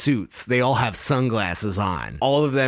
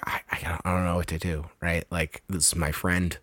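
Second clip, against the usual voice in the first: low, soft worried voice; quietly agonize over a friend; troubled hero; 5 semitones lower, 5 dB quieter than usual. Retold or imitated. Hero torn about his friend.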